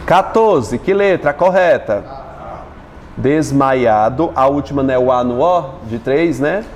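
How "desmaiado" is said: "Desmaiado" slow, in separate syllables. Shown as a demonstration. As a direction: sound out "Desmaiado" piece by piece. Checking syllable division aloud.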